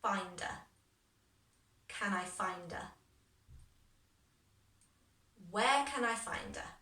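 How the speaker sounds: linked "find a", adding words chunk by chunk; built up backwards. Shown as a demonstration. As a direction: pause after each growing chunk for repeating. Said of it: "So try that bit after me".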